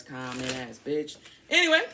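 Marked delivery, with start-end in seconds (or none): 1.5-1.8 sharp reset. Real, not acted. Shaking off the rant.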